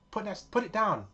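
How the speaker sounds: high, loud barked command; retold shop woman shouting; shouted order. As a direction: yell at her to put it down. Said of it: "the Caucasian shouted over".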